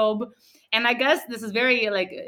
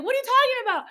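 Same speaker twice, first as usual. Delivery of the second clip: high-pitched retold disbelief; squeaky-high, thoroughly confused; bewildered question; 13 semitones higher, faster than usual. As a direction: act out baffled protest. Retold baffled reply.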